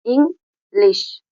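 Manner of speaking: इंग्लिश drawn out slowly as इंग, लिश; slow syllable split. Shown as a demonstration. Teacher shows students the two syllables, slowly. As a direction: stretch इंग्लिश so both syllables are heard. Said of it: "हमारी language में ही दो syllable हैं".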